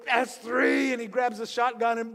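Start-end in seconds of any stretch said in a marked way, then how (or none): 0.1-0.9 loud final count; farmer's shout. Retold or imitated. Farmer shouting at the mule before the shot. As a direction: shout the last number. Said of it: "he goes".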